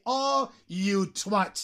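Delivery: "Oh, you twat" very slow, each word held apart; drawn-out insult. Shown as a demonstration. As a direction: stretch "Oh", pause, then "you twat". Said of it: "Se dice así". ("Oh, you twat" muy lento y alargado)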